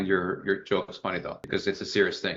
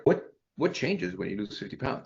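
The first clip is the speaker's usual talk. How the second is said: playing dumb; 4 semitones higher, 4 dB quieter than usual. Shown as a demonstration. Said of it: "you play dumb".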